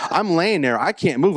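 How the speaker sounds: normal talking voice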